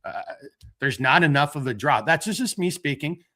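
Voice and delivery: usual speaking voice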